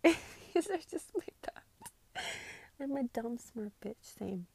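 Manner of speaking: slow, laughing near-whisper. Real, not acted. Laughing at relatable tweet.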